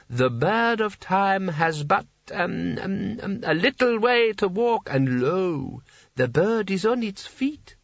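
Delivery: high, slow, groping recital with ums; a drunk old king making up verse; tipsy poet reciting. A narrator's reading. In the act of composition.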